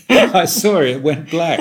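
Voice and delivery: words bubbling with laughter; laughing. Real, not acted. Laughing retelling phone.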